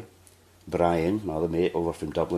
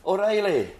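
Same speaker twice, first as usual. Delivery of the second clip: shouting back; 13 semitones higher than usual. Retold or imitated. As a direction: yell the name right back in delight. Friend shouts back in recognition.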